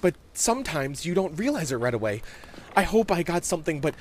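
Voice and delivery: put-on earnest child defending his faith, faltering midway; hopeful young boy. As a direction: reassure yourself out loud. Voices Bobby, hopeful.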